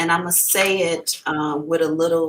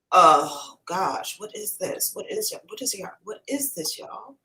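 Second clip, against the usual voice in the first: very soft, puzzled murmur; puzzled muttering; 6 dB quieter than usual. Real, not acted. Psychic puzzling over what is coming through, quiet muttering. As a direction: mutter it half to yourself, barely audible.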